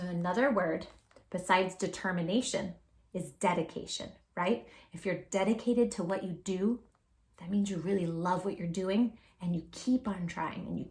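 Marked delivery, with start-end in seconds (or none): none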